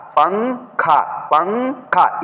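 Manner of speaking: पंखा with the n half out, half in the nose; half-nasal पंखा. Shown as a demonstration. Teacher sounds out पंखा for pupils, half nasal. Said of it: "इसको भी नाक से पढ़ा हूँ".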